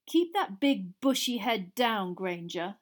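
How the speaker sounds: slow, sneering jab; Malfoy parting shot at Hermione; sneered. A narrator's reading. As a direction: spit the insult. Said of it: "sneered Malfoy".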